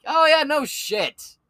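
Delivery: high, loud, slow drawn-out "no shit"; sarcastic scoff. Real, not acted. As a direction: sneer at the obvious. Sarcastic reaction to news.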